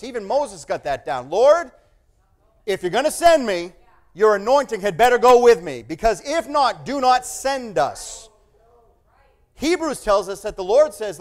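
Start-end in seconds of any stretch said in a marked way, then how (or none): 1.3-8.3 loud, insistent demand to God; bold plea. Acted. Moses pleads loudly with the Lord. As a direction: insist on God's presence.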